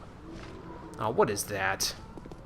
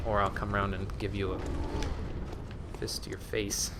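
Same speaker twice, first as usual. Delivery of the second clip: slow, hushed playful menace; quiet mock threat; 4 semitones lower, 8 dB quieter, slower than usual. Player teasing a hidden NPC with a soft slow threat. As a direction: murmur the threat with a smirk.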